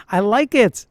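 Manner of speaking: ordinary talk, nothing marked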